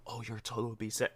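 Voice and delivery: timid murmur of the total; mock deferential quiet. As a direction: imitate a cashier hushed for a phone talker. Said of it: "If you expect to be like".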